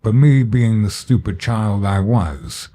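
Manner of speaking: plain reading voice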